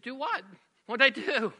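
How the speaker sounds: high-pitched, baffled self-defense; bewildered protest. Retold or imitated. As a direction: protest innocence to an upset wife. Bewildered husband defends himself, retold.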